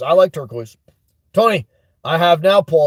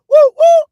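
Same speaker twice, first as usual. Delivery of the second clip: high, loud double woo; excited whoop; 7 dB louder than usual. Real, not acted. Streamer cheering for a new member, excited whooping.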